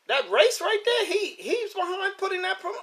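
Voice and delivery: high, astonished questioning voice; imitated incredulity. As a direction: play a fan who can't believe it. Voicing a surprised viewer.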